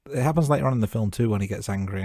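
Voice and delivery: ordinary talk, nothing marked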